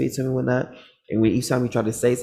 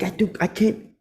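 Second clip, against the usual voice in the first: high, loud, fed-up complaint; irritated man; 10 semitones higher, 4 dB louder than usual. Retold or imitated. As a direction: snap it in irritation. Angry bald man quoted.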